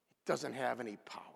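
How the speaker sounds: quick unvoiced whisper. Real, not acted. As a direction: breathe it fast like a secret. Whispered aside.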